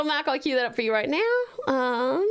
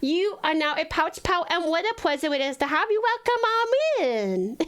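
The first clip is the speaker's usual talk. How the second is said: put-on baby talk; 2 semitones higher than usual. Streamer welcomes new follower Stella, cutesy baby talk. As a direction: coo a welcome in toddler speech, r and l as w. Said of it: "I promise I don't normally talk like this".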